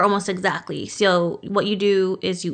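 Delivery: ordinary talk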